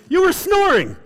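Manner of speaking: very high, loud mock yell; hammed-up shrieked retort; exasperated shout. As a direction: shout back like a fed-up wife. Acted wife's retort in quarrel.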